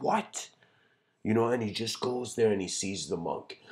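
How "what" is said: high-pitched outraged outburst; angry warlord. Retold or imitated. Warlord reacting to news of the old monk, angry. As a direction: jump up high in mock outrage. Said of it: "the warlord gets angry".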